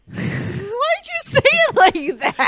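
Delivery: high, slow, drawn-out disbelief; amused surprise. Real, not acted. Surprised, laughing at her pronunciation.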